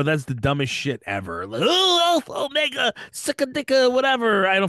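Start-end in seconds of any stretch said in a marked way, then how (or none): 1.6-4.5 mocking. Mocking fraternity names.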